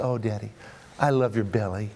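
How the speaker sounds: put-on soft, low childlike sincerity; retold tender affection. Daughter to dad, hand on his tummy, retold tenderly. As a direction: murmur it fondly as a retold endearment.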